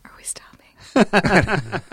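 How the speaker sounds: slow breathy whisper. Real, not acted. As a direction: ask it under your breath, slowly. Quiet aside during recording.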